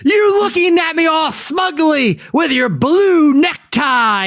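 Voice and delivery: high, slow accusation; accusing actor. Retold or imitated. Actor accusing a panelist, high and slow. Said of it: "he's like".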